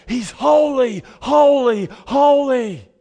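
loud, high proclamation of holy; ringing angelic shout; angels crying out. Acted. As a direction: call it out across the heavens. Angels crying out to one another.

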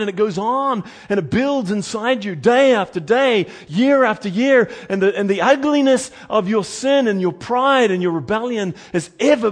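loud, high preaching voice; impassioned preaching. Real, not acted. Preacher warning the congregation about sin inside, impassioned. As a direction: drive it home with rising force.

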